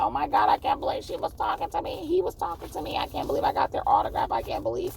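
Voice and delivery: very high, squealing fangirl voice; hammed-up high-pitched fan frenzy; starstruck fans. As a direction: gush like a fan after meeting a celebrity. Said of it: "people gonna be like".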